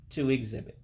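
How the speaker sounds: ordinary talk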